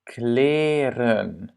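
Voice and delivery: Kleren slowly, first E held long. Shown as a demonstration. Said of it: "le premier E est long". (« Kleren », premier E bien long)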